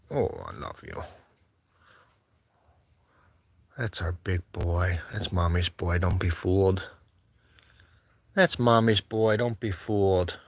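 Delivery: low, soft cooing to the cat; tender pet talk, low and soft. Real, not acted. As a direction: croon to your pet.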